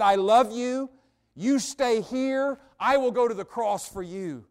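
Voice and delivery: loud, high, slowing declaration; impassioned preached quote. Acted. Preacher voices God's promise to go to the cross, impassioned. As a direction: proclaim it with full conviction, easing down in pitch.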